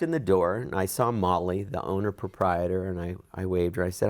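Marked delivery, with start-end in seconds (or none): none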